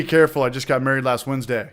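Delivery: ordinary talk, nothing marked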